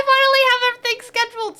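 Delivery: fast, high giddy laughing delivery; laughing happily. Real, not acted. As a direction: bubble over with giddy joy. Happy about schedules, laughing.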